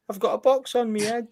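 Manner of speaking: bad English accent. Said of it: "appalling attempt at an English accent".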